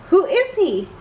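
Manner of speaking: puzzled and a little suspicious; curious villain girl. Acted. Luna Girl wondering aloud to her little sister.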